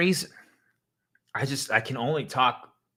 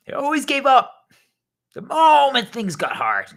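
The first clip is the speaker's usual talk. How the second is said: shouted, high and loud; frustrated outburst; 11 semitones higher, 8 dB louder than usual. Acted. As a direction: vent it like a fed-up mentor. Rant about quitters.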